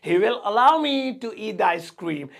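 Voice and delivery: very slow, word by word; slow model sentence. Shown as a demonstration. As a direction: dictate it slowly for learners. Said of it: "slow model sentence".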